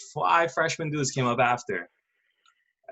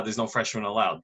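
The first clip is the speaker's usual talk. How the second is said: ordinary delivery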